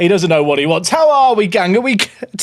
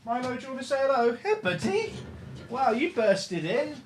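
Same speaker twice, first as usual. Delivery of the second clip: dog talk; 6 semitones higher, 11 dB quieter than usual. Real, not acted. Talking to the dog.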